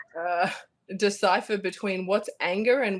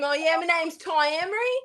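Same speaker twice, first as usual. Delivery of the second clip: high, put-on voice mocking own accent; self-mocking introduction; 8 semitones higher than usual. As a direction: exaggerate your own twang, rising at the end. Mocks own plain accent beside Scottish.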